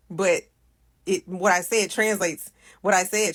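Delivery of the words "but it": ordinary delivery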